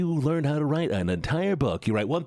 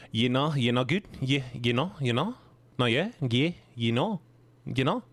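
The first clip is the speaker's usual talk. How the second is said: Kiwi accent, every phrase rising; New Zealand accent. Shown as a demonstration. Streamer practising Kiwi filler words, accent rising each time. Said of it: "stereotypical New Zealander accent".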